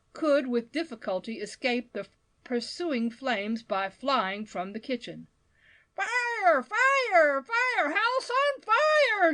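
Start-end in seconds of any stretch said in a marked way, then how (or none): 6.0-9.3 high, loud, drawn-out fire cry; alarmed shout. A narrator's reading. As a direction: bellow the alarm into the street. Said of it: "shouted Mister Badger".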